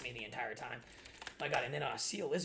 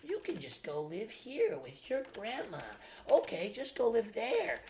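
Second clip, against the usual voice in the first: higher, slow taunting imitation; mocking stepmom; 7 semitones higher, slower than usual. Son mimicking his stepmother's random threats, slow high mock voice. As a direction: drawl it out mocking her. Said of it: "she would just say".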